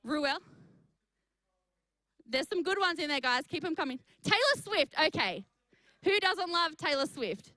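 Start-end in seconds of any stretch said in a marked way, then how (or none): 4.2-4.9 excited. Real, not acted. Reading a comment out eagerly.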